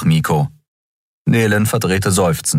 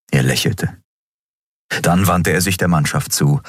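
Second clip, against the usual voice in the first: usual speaking voice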